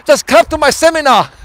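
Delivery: put-on high accented pitchman catchphrase; hammed-up guru, seminar ending in NAH; Tom Vu. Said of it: "Tom Vu".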